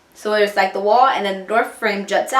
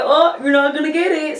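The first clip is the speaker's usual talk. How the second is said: playful tease; 4 semitones higher than usual. Retold or imitated. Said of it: "playful manner".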